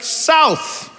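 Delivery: terse local answer. Retold or imitated. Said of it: "people are just like".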